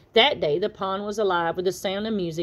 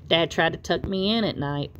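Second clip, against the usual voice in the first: ordinary delivery, nothing marked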